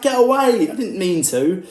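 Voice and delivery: imitating a player shoving away a critic; a hammed-up, high brush-off; mock defensive shout. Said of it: "it's like".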